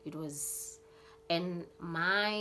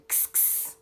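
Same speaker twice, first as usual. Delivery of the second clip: whispered kss kss to call dogs; breathy kss calling the pack; dog-calling hiss; 3 dB quieter than usual. Retold or imitated. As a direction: hiss softly to summon the dogs.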